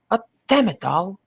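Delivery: sharp, loud frustrated curse; swearing. Acted. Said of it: "I swore".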